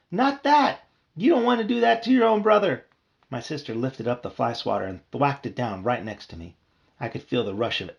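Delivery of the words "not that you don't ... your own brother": high, loud frantic plea; desperate plea. Acted. Bee begging his sister not to swat him, frantic. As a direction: beg her not to swat. Pleading against swatter.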